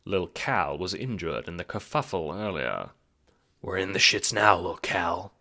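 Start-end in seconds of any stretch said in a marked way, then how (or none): 3.6-5.3 Bro's voice. A narrator's reading. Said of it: "Bro says".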